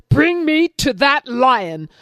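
lion ordering rabbit, slow and furious. Acted. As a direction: bellow it slowly, enraged. Lion demands.

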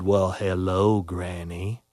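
low, soft, sly wolf greeting; big wolf greeting granny, low and quiet. Acted. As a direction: greet her like a hungry wolf.

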